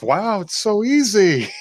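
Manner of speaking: slow, high mock wonder; amazed. He marvels at the electric sewing machine, amazed.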